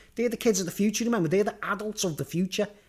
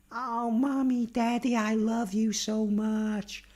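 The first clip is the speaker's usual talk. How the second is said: put-on slow, higher, sugary child voice; gushing kid; 4 semitones higher, much slower than usual. Kid to parents after the real phone, slow sugary gushing. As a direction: lay on the fake sweetness like a spoiled kid.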